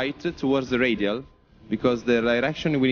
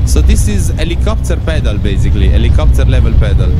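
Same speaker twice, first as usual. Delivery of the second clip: tense loud takeoff calls; urgent, loud, straining; shouting over the wind; 2 semitones higher, 11 dB louder than usual. Real, not acted. Rough crosswind takeoff, straining.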